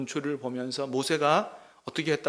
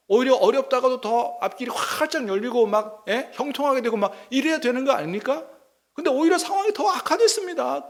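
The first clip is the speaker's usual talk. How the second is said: higher, louder, building outrage; arguing with God; 7 semitones higher, 4 dB louder than usual. Acted. Moses arguing with God in prayer, higher and louder with rising outrage. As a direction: demand an answer from God. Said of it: "막 따지듯이 지금 기도하는 거죠".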